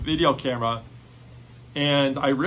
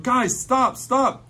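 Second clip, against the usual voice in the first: high, urgent call to stop; breathless high call to friends; 10 semitones higher, 3 dB louder, much slower than usual. Retold or imitated. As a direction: shout after them to halt. Calling after friends to stop.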